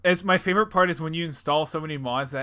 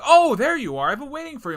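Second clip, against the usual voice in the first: high, swooping hammed-up welcome; mock cheery greeting; 11 semitones higher, 9 dB louder than usual. Game character greeting the player after waiting, hammed-up cheer. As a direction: greet them as if nothing happened, pitched up.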